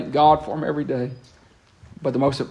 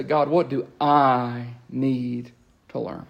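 slow, softer humble question; humble asking; 4 dB quieter, much slower than usual. Retold or imitated. Preacher modelling the right prayer to God, slow humble ask. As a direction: ask gently, ready to listen.

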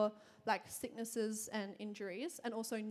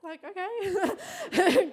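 laughing; 9 semitones higher, 9 dB louder, much slower than usual. Retold or imitated. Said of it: "she's like".

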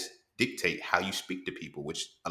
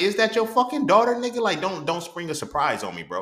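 shocked outburst; 10 semitones higher, 7 dB louder than usual. Retold or imitated. Retold shock at dad's news.

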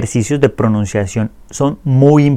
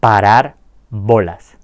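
slow model pronunciation; slower than usual. Shown as a demonstration. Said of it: "voy a decir la expresión".

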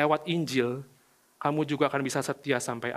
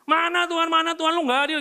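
much higher, loud accusing cry at God; mock angry protest; 14 semitones higher, 6 dB louder than usual. Preacher mimics people yelling at God that He is unfair, loud and high. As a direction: shout the complaint up at heaven. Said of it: "Kita suka gitu kan sama Tuhan".

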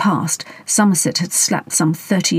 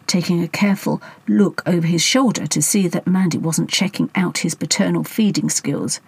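usual speaking voice

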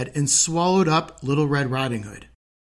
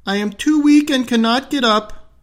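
put-on old grandmother calling; 9 semitones higher, 4 dB louder than usual. Grandmother calling from bed to the door, frail. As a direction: call out like a frail old granny. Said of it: "called out the grandmother".

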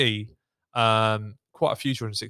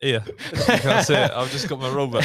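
laughing; 4 dB louder than usual. Real, not acted. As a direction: laugh as you talk. Joking about a rule book.

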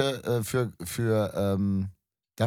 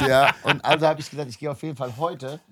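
laughing; 3 semitones higher, 3 dB louder than usual. Real, not acted. Laughing while continuing her story.

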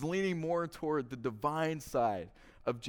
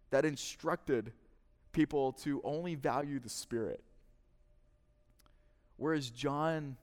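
usual speaking voice

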